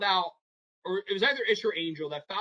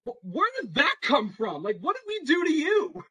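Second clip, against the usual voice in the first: mock indignant disbelief; 10 semitones higher, 4 dB louder than usual. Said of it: "I'm like".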